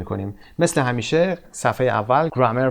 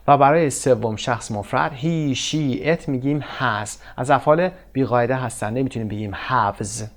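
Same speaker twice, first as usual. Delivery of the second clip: ordinary talk, nothing marked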